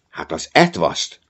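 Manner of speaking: plain reading voice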